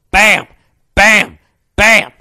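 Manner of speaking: loud punchy bam bam; cartoon impact sounds, shouted; pipe hit noises. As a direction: slam out each bam like a pipe blow. Imitating pipe hits.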